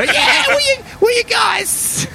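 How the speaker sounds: very high goblin, slowing at the end; small goblin voice. Acted. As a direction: stretch it out high like a little goblin. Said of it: "won't we, Jingo".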